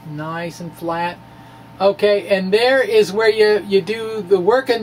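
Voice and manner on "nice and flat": a quiet, slow repeat of "Nice and flat"; soft echo. Shown as a demonstration. Teacher echoing himself softly, slow and savoring.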